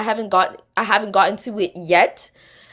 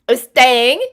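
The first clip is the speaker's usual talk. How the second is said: put-on British accent; 3 semitones higher, 6 dB louder than usual. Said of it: "I cannot not use a British accent".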